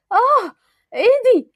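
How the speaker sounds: sudden pained cry. Acted. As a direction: yelp as she hits the ground. Falls and hurts her hand.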